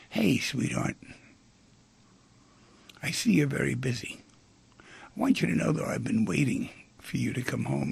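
gently approach a busy sweetheart; loving. Acted. Loving man asking his busy partner to get closer, tender. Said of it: "if he was loving".

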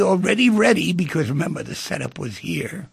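usual speaking voice

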